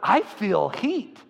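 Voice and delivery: retold wide-eyed amazement; amazed. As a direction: stretch each word as if astonished by the feeling. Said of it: "She's like".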